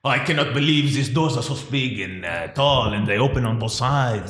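mock French accent, deliberate phrasing. Podcaster imagines a Frenchman marvelling at doors, French accent. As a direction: put on a comic French accent. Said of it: "I love your French accent".

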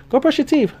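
high grandma voice, soft; a hint of grandma giving an order. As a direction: voice grandma telling him off.

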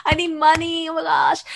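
mock whine, pitched high; desperate brother. Sister imitating her money-hungry brother, high mock desperation. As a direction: imitate a kid pleading for cash.

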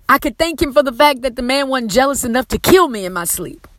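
usual speaking voice

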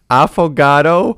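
affogato loud and slow, high rising; mock puzzled reading. Imitating the friend reading the menu, loud puzzled affogato. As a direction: drag out affogato as a loud question. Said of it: "imitating the reading".

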